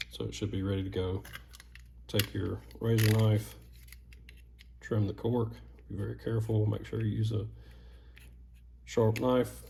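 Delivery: usual speaking voice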